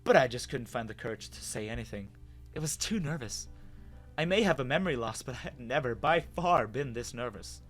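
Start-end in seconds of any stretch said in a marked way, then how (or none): none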